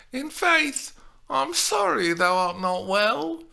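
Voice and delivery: high, loud mock-sorry apology; butter her up with an apology; diplomatic contrition. A narrator's reading. Juliet apologises to her nurse, diplomatically. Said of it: "in diplomatic contrition".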